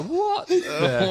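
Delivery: very high, loud mock bewilderment; hammed-up shocked squeal; stunned disbelief. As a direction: blurt it out like a flabbergasted rookie. Stunned young player's retold reaction.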